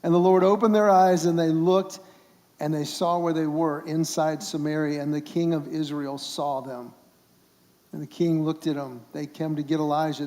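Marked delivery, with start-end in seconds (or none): none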